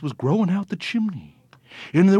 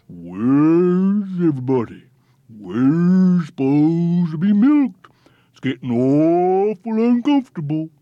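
complaining cows; 6 dB louder than usual. A narrator's reading. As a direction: moan it out like uncomfortable cows.

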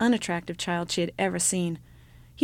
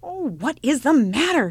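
cried out; 6 semitones higher, 5 dB louder than usual. Acted. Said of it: "she cried".

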